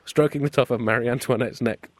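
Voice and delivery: ordinary talk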